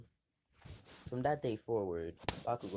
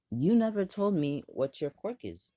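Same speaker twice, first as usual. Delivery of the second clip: put-on loud, high bossy kid; bossy demand; 9 semitones higher, 10 dB louder than usual. Bully demanding to know the boy's quirk, loud and high. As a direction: bark the question at him.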